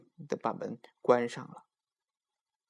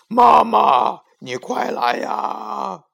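lion echo; 9 semitones higher, 8 dB louder, much slower than usual. A narrator's reading. Lion echoing the boy.

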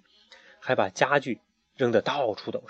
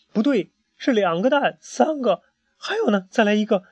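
rising amazement; 9 semitones higher, 4 dB louder than usual. A narrator's reading. Eggs keep appearing.

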